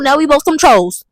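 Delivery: ordinary talk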